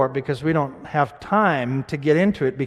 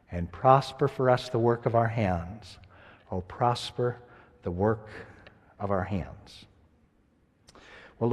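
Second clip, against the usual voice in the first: soft, reverent prayer voice; hushed closing prayer; quiet prayer; 6 semitones lower, 6 dB quieter than usual. Acted. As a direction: pray it gently, winding down.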